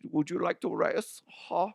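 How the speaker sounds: lightly put-on German accent. Host joking about a race in Germany, mock German accent. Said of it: "that's my German accent".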